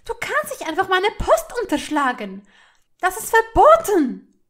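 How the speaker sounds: high, very loud protest; outraged shouting. A narrator's reading. Hermine to Malfoy over her withheld post, outraged shouting. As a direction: explode at him in outrage. Furious outburst after gasping for air.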